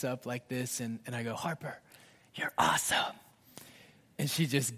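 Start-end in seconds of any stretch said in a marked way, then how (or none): 1.3-3.1 tender whispered secret; soft loving whisper. Retold or imitated. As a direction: breathe it into a child's ear.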